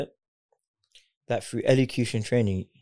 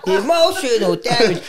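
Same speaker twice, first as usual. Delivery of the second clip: meme voice; 12 semitones higher, 6 dB louder than usual. Retold or imitated. Quoting a meme in a funny voice.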